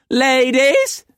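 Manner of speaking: very high, loud screech. A narrator's reading. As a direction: screech for the girls. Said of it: "spun around and screeched".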